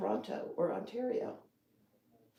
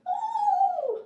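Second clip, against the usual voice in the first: surprised squeak; 6 dB louder than usual. Real, not acted. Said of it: "just gave a little squeak".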